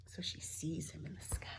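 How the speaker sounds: hushed whispered aside. Real, not acted. Reader showing children the picture, hushed whisper. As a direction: whisper it like a secret.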